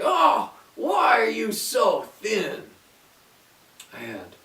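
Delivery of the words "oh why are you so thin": slow, louder puzzled witch. A narrator's reading. Witch feels the thin bone, slow and puzzled. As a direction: grumble in frustration like the witch. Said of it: "she'd think".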